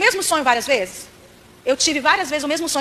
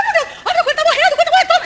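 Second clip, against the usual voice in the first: much higher, shrieking panic; panicked mother; 13 semitones higher, 5 dB louder than usual. Retold or imitated. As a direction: freak out as the rabbit dies. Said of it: "eu falei". (pânico aos gritos)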